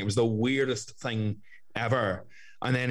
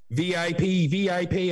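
raised, higher mock announcement calling VIP; 7 semitones higher, slower than usual. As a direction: clear the way like a herald ringing a bell. Woman clearing hospital corridor.

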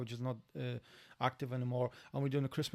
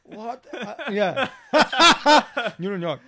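laughing; 8 semitones higher, 14 dB louder, slower than usual. Real, not acted. Laughs at accent remark.

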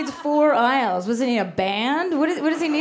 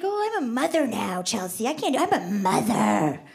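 lightly mocking self-important mom; a hint of a precious new mother, drawn on MOTHER; smug new mother; 3 semitones lower than usual. New mother excusing herself to Chelsea, mildly smug. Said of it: "people who act like they have a baby".

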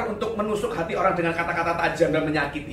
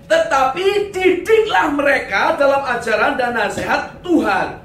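slow, loud, higher-pitched declamation; emphatic scripture reading; 5 semitones higher, 6 dB louder, slower than usual. Acted. Preacher quoting the command to fathers, emphatic declamation. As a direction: proclaim the verse deliberately. Declaims the key Bible verse.

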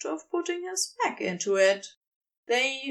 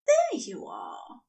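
awed whisper; breathy awe; 3 semitones lower than usual. A narrator's reading. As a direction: breathe it out in wonder. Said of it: "Aziraphale's voice is breathy with awe".

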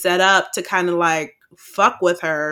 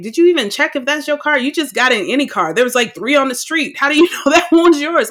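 higher and fast, laughing in disbelief; incredulous scolding; 7 semitones higher, faster than usual. Retold or imitated. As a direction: grill her like she's lost her mind. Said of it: "And I'm like".

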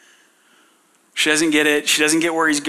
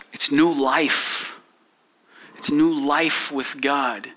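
slow and deliberate; 2 semitones lower, slower than usual. Real, not acted. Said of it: "slow emphatic statement".

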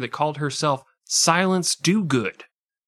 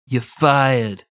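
louder TV boss catchphrase; louder mock catchphrase; 6 dB louder than usual. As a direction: deliver the firing line. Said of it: "his brother could say".

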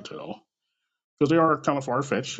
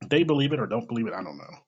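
ordinary talk, nothing marked

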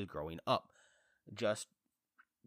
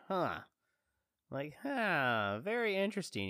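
mock intrigued; 8 semitones higher than usual. Mock reaction to teaser.

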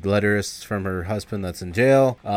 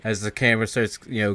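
normal talking voice

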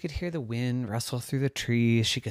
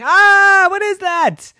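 very high, loud frightened cry; screaming; 13 dB louder than usual. A narrator's reading. Maven frightened by noises in the cave, screaming high. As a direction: scream it out in alarm. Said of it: "She was screaming".

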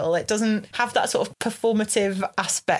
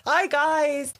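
mock greeting pitched up high; playful greeting; 6 semitones higher than usual. Acting out a walk past Leeds bars, playful high greeting. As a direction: hail passers-by in a high, jokey voice.

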